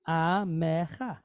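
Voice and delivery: Amecha spoken slowly, syllable by syllable. Shown as a demonstration. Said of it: "One, two, three".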